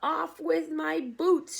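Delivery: loud, high, slow yell; shout. Acted. Centipede shouts to friends to pull off his boots, loud. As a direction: yell urgently for help. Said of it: "shouted the centipede".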